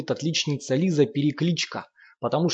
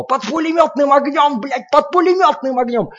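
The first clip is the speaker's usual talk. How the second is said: much higher, louder, hoarse from yelling; hoarse raging roar; 13 semitones higher, 9 dB louder than usual. A narrator's reading. Lomov still raging at the children, hoarse roar. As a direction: keep raging, voice worn from roaring. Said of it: "не унимается Ломов, охрипший от своего рёва".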